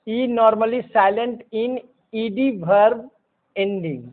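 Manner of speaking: very slow, each word apart; slow dictation. Shown as a demonstration. Teacher dictating the E rule very slowly. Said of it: "teacher dictating the rule".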